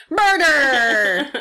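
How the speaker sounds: loud, high dramatic shout; mock shout. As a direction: blurt it out like a horror reveal. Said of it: "and it's like".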